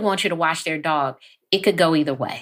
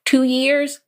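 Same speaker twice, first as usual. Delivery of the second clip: high and louder exasperation; exasperated louder Two years; mild mock yell; 10 semitones higher, 4 dB louder than usual. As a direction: fling it out, fed up. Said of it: "you might yell the boundary".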